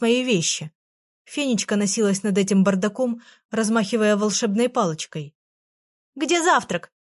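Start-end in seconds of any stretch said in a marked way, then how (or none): 6.1-6.9 mild cry. A narrator's reading. Fairy demanding breakfast from girl, mildly crying out. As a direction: call out for it impatiently. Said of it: "крикнула она".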